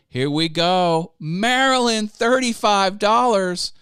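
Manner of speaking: loud, high excited shout-out; big, high hype-up for a donor; excited announcement. Real, not acted. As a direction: call out the donation, drawn out and thrilled. Announcing a viewer's donation.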